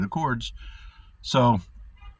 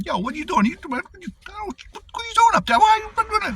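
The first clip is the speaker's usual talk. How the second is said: much higher and louder, exasperated shouting upward; mock outraged yell at God; 5 dB louder than usual. Calling up to God in mock outrage, high and loud. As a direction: yell up to the sky like a frustrated buddy.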